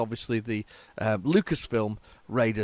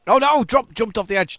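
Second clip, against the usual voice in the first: loud, high oh no; alarmed cry; 10 semitones higher, 9 dB louder than usual. Real, not acted. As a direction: yelp as you see it fall. Sees his character fall.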